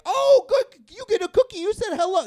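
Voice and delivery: very high, loud dog-owner delight; gushing praise. Acted. Owner rewarding a dog for pressing a button, high-pitched gushing. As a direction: gush in a high voice at a clever pet.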